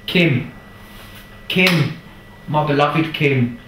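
high, loud, drawn-out call of her name; fond calling out. Real, not acted. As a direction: call out to her across the room, full of affection. Calling out to his cleaner.

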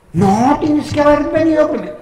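scolding manager voice. Retold or imitated. Manager scolding, retold argument.

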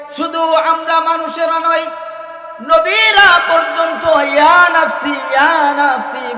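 high shouted cry of ইয়া নাফসি; preaching shout. Acted. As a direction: cry it out like doomsday. Preacher shouting Judgment Day. (চিৎকার করে ইয়া নাফসি)

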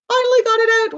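triumphant little squeal of release; excited relief. Real, not acted. Cheerful sign-off after reading.

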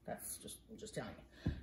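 unvoiced confiding whisper. Real, not acted. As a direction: whisper it like a secret. Aside to kids.

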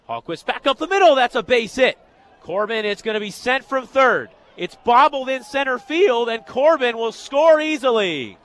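energized sportscaster calling a hit; excited play-by-play. Real, not acted. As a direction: ride the action as the run comes in. Calls an insurance run live.

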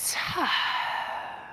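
long, voiceless exhale; letting-out sigh. Shown as a demonstration. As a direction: let the whole breath out in an open sigh. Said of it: "Let it out".

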